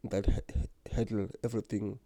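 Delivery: usual speaking voice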